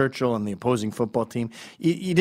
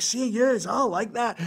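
Scottish fan cheering; 9 semitones higher, slower than usual. Retold or imitated. Mimicking underdog-loving Scots.

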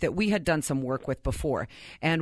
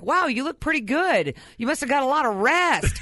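teasing sarcastic; 6 semitones higher than usual. Retold or imitated. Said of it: "John's like".

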